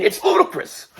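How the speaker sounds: high, squeaky Tyson-style "ludicrous"; Mike Tyson impression.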